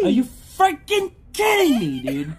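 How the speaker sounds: high, slow disbelieving yell; excited scream into laughter. Real, not acted. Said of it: "You scared me".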